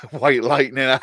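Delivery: slow, loud words through laughter; laughing. Real, not acted. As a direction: call it out while cracking up. Cracks up at own drink joke.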